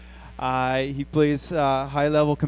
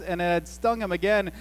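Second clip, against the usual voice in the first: ordinary talk, nothing marked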